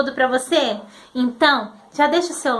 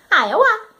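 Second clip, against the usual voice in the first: imitating child; 5 semitones higher than usual. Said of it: "Ainda faz assim".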